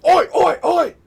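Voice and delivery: loud, high-pitched hooting ois; mock gorilla yelling. Player reenacting gorillas swinging from the ceiling, mock yelling. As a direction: belt out each oi like a raiding gorilla. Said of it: "hear something unusual coming from them".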